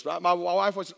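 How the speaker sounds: ordinary delivery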